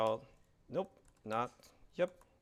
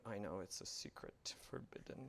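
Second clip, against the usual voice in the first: a hushed, breathy aside to the room; mock whisper; 6 semitones lower, 15 dB quieter than usual. Presenter to audience, joking about a name, in a mock whisper. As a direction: whisper it like a playful secret.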